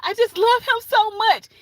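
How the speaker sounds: mock lovesick girl.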